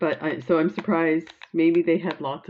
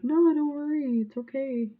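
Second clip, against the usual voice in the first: put-on higher, gently comforting child voice; reassuring little sister; 9 semitones higher than usual. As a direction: soothe an upset big sister. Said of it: "I was like".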